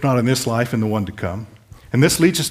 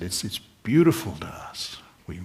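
whispered; 3 semitones lower, 3 dB quieter than usual. Real, not acted. Sermon drops to a hush.

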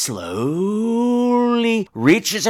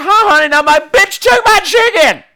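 upset wail; 13 semitones higher, 5 dB louder than usual. Retold or imitated. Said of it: "Mister Brad's like".

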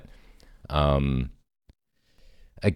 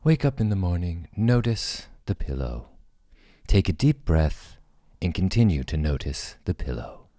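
mock meditation app. Shown as a demonstration. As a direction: lead a morning self-care meditation gently. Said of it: "your voice is perfect for it".

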